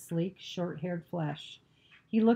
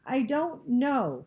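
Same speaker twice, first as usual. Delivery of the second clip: slow, high, tearful protest; choked up; 8 semitones higher, 5 dB louder, much slower than usual. A narrator's reading. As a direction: draw it out, close to crying. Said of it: "her voice choked".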